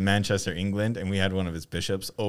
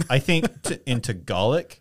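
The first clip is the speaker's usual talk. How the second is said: ordinary talk, nothing marked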